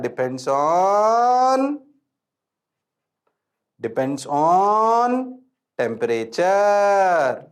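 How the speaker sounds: slow and drawn out. Shown as a demonstration. Teacher slows right down so students remember temperature dependence. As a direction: stretch each word very slowly. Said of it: "धीरे धीरे इसलिए बोल रहा हूं".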